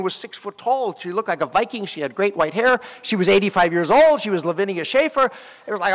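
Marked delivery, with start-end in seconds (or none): none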